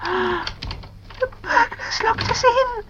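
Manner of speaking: high, slow, breath-filled frightened whisper; scared whisper. A narrator's reading. Girl locked in by a burglar, scared whisper. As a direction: whisper it in dread, drawn out. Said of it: "whispered Pam, very scared".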